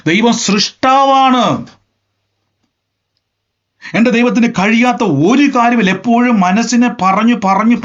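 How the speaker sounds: fired-up preacher driving his point home; fervent preaching. Acted. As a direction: preach it with rising fervor. Preaching at full force.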